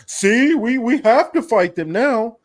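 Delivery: high-pitched imitation of an excuse; mock justification. Host mimicking officials justifying a war on Iran, mock protest. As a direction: pitch it way up, mimicking someone pleading their case. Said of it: "then they can be like".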